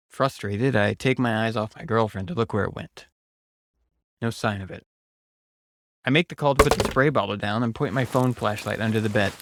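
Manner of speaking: plain reading voice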